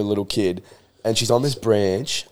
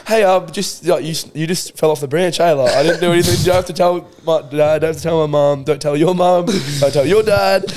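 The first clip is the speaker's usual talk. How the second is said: pleading; 7 semitones higher, 4 dB louder than usual. Retold or imitated. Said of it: "I went up to her, like".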